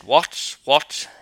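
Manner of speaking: put-on regional Treves accent on what; a ferryman's Treves dialect. A narrator's reading. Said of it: "in the way people speak in the neighbourhood of Treves".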